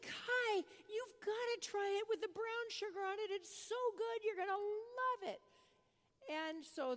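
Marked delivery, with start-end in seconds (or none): 0.0-5.4 put-on high, eager urging to a child; enthusiastic coaxing. Grandmother urging grandson to try breakfast, enthusiastic coaxing. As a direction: coax brightly in a raised pitch.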